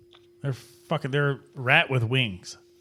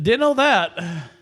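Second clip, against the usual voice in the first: mock surprise; 7 semitones higher, 4 dB louder, much slower than usual. Slow exaggerated surprise.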